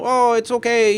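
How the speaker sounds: usual speaking voice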